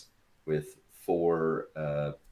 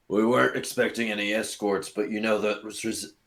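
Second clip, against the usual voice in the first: rough, throaty monster voice; gruff bugbear voice; 4 semitones higher than usual. Acted. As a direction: growl it like a bugbear. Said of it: "that was rough on my throat".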